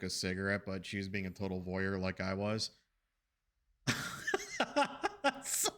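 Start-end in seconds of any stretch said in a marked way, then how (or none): 3.8-5.4 high, breathless burst of laughter. Real, not acted. Admitting to nosiness mid-story, high giggling laugh. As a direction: crack up at your own joke. Amused at own voyeurism confession.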